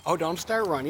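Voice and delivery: ordinary delivery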